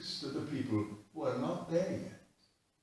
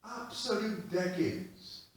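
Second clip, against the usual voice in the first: ordinary talk, nothing marked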